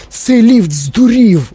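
high, drawn-out panicky cry; alarmed yelling. A narrator's reading. Grandpa Joe cries out in the soaring lift, alarmed. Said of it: "репетував дідунь Джо".

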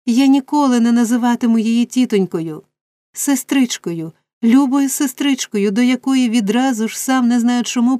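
crying out. A narrator's reading. Oliver declares Rose his dear sister, crying out. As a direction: cry out with rising devotion. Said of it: "скрикнув Олівер".

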